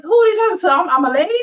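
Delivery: high, indignant snap; offended woman. Retold or imitated. Women scoffing at a polite greeting in a high indignant voice. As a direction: bristle at being called a lady. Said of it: "They look".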